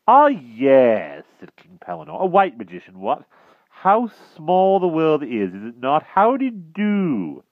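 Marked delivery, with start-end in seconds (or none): 0.1-1.2 high, loud bumbling knight; blustering old knight. Acted. Pellinore voice. 2.2-7.4 high, loud bumbling knight; blustering old knight. Acted. Pellinore voice.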